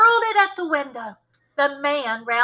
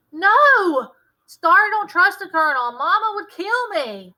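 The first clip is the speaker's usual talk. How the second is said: high, loud alarmed protest; 9 semitones higher, 6 dB louder than usual. Acted. Boy refuses to tell the Colonel, alarmed. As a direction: shut the idea down in alarm.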